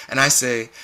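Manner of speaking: plain reading voice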